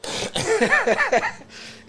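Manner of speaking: high burst of real laughter. Real, not acted. Laughing at his own mixed ancestry result. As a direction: laugh it off, amused.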